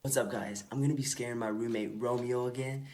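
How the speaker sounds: very soft, low hushed voice; hushed secret vlog. Acted. Prankster whispering plans to scare his roommate, hushed. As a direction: keep it down, confiding to the camera.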